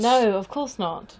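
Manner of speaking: gasped, slow, loud mock shock. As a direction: gasp in fake outrage. Mock shocked reply to joke.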